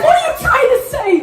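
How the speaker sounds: fast, high and half laughing; squeaky shocked question. Retold or imitated. Comedian asking friends what they mean, fast high squeak. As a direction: blurt it out in disbelief. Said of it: "And I'm like".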